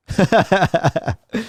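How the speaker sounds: high staccato chuckle; a run of quick, high ha-has; high laugh. Real, not acted. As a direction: laugh out in an even, high-pitched string. Laughs after thanking the band.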